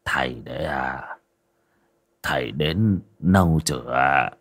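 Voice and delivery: low, whispered, hoarse and slow; a dazed widower croaking a greeting; husky, barely voiced old man. A narrator's reading. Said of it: "giọng khàn khàn".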